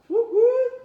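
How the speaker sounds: high, loud whoop; cheering. A narrator's reading. Teacher voicing an animal celebrating the invite, loud and high. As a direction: celebrate at full volume.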